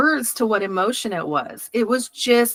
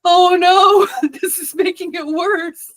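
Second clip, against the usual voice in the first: high, loud mock wail of dismay; retold dismay; 9 semitones higher, 5 dB louder, slower than usual. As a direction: wail it in hammed-up alarm, breaking into laughter.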